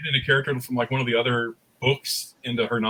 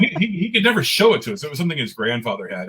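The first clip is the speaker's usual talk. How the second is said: ordinary talk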